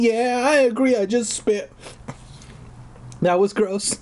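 ordinary talk

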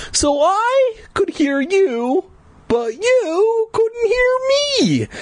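slow, high, crying words; dawning dread, slow and tearful; tearful realisation. A narrator's reading. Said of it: "I murmured".

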